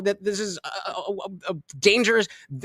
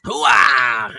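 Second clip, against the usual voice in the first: loud gung-ho soldier; 9 semitones lower, 4 dB louder than usual. Pretend. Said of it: "I will go with the dilly dilly".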